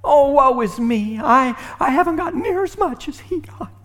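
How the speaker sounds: mocking the older son's self-righteous sulk; self-pitying mock. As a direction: ham up the self-pity. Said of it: "Oh, he's self-righteous, isn't he?".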